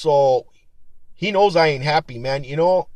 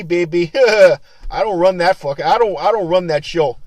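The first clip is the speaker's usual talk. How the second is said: ordinary delivery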